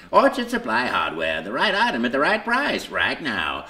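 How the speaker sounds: punchy commercial voiceover read. Retold or imitated. Reading the script for the producer, a commercial voiceover. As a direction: sell it like a radio spot. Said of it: "Well, read this script".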